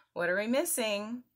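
usual speaking voice

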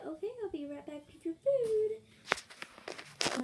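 high, slow waitress sing-out; changed waitress voice. Pretend. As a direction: draw it out in a high put-on voice. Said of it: "Her voice changed".

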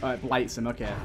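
ordinary delivery

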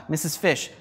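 ordinary delivery

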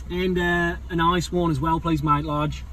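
loud, matey Aussie drive-through order; Australian accent. Pretend. Ordering coffee at the drive-through, Australian accent. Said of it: "Do it in an Australian accent".

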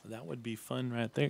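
normal talking voice

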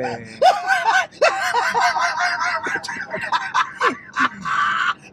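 high, loud screaming burst of laughter; shrieking laugh. Real, not acted. Host howls with laughter at his friend's story, shrieking laugh. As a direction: explode in a howling laugh.

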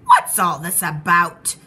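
loud, low crowd voice; booming, drawn-out bewildered shout; shouted question. A narrator's reading. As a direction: bellow it slowly, demanding to know. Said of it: "the people came shouting".

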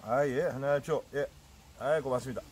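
talking while chewing food; speaking between bites; mouth full. Real, not acted. Seen 0: Holds leaf wrap throughout; cheeks look full, lips pursed mid-speech.

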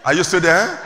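ordinary delivery

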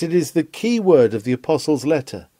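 ordinary talk, nothing marked

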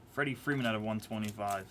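ordinary talk

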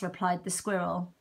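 usual speaking voice

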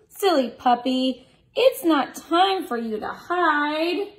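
slow, high, playful storybook voice; drawn-out, playful scolding for kids; reading to children. Acted.

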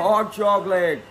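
drawn-out hot chocolate; slow savoring. Real, not acted. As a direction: say hot chocolate very slowly, relishing it. Repeats drink name, savoring.

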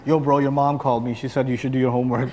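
low, laid-back buddy voice; friend relaying message. Acted. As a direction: lightly play the kid's friend, pitched low. Friend relaying mom's phone message.

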